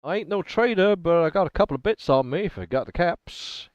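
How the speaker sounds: lightly Southern twang trader; hammed-up Southern accent. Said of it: "he says in a fucking southern accent".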